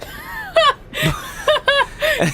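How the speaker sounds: high burst of disbelieving laughter; sharp high laugh of disbelief; incredulous laugh. Real, not acted. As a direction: laugh it off as a joke. Reacts to the Undertaker suggestion.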